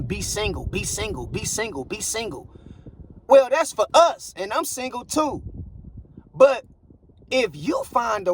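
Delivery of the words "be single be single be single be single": softer, monotonous mimicked refrain; imitating repeated chorus. Mimicking everybody pushing singlehood on others, a soft repeated refrain. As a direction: repeat it quietly like an imitated slogan. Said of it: "Everybody is pushing".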